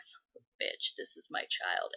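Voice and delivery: dad's imagined curse, whispered; hushed, voiceless mock exasperation; whispered dismay. Dad cursing to himself about his son, whispered dismay. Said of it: "you can see him being like".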